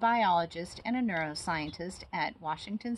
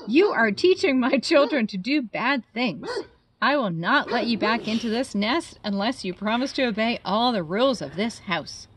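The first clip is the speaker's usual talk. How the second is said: louder, firm laying down rules; stern mother bird; 4 semitones higher, 10 dB louder than usual. Acted. Mother bird telling Stellaluna the house rules, stern. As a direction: scold the bat like a strict parent.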